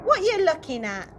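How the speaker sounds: put-on tough teen girl snapping a challenge; bully's shout. A narrator's reading. Teen bully shouting at passers-by, sharp challenge. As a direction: bark it at passers-by. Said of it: "Tina Trotz would shout at everyone".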